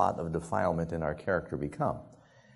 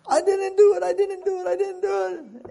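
very high-pitched protesting wail; mock childish wail; 5 dB louder than usual. Boy being spanked by his dad, mock wailing denial. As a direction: wail your innocence like a spanked kid.

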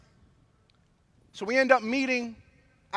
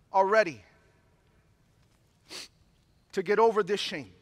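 prayer voice; 2 semitones lower than usual. Acted. Leads closing prayer.

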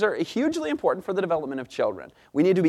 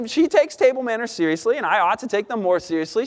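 ordinary delivery

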